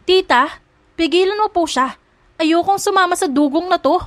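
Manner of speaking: high-pitched protesting outcry; pleading shout. A narrator's reading. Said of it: "Sigaw ni Isabela".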